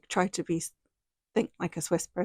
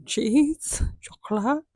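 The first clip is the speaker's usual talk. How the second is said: playful guessing; 7 semitones higher, 3 dB louder, slower than usual. Retold or imitated. Answering the French lady playfully.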